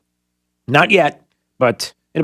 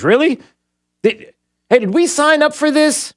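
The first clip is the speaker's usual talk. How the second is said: much higher, incredulous sarcasm; mock disbelief; 10 semitones higher than usual. Real, not acted. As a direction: scoff at the headline. Mocking the climate bill.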